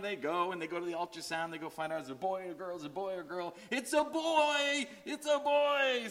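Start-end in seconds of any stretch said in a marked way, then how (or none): 3.7-6.1 high, loud joyful cry; bursting with new-parent joy, slow and high; excited shout. Pretend. New parents at the ultrasound, shouting with joy.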